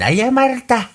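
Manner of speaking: ordinary delivery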